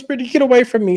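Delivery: high-pitched mock protest; outraged. Lisa sends Brittany away, outraged. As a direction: imitate a hysterical brush-off.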